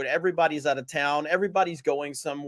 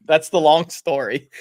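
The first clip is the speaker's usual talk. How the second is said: ordinary delivery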